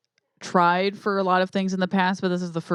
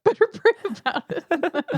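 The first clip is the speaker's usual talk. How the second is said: laughing; 11 semitones higher than usual. Real, not acted. Co-host cracking up, repeating the host's joke through laughter. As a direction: echo the line through real laughter, pitched up.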